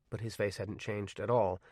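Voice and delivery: usual speaking voice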